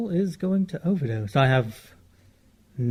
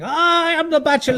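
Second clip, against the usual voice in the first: pompous bachelor king; 12 semitones higher, 5 dB louder than usual. Pretend. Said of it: "I could see you".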